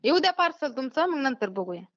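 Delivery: ordinary talk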